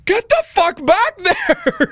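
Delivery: high, loud, drawn-out mock shout; urgent order. Triage nurse ordering patient back, slow high urgent shout. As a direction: yell a frantic command, stretching it slowly.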